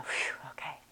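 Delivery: whispered sigh of relief; a soft relieved whisper from the owner. Retold or imitated. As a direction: breathe it out in relief. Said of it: "And they go".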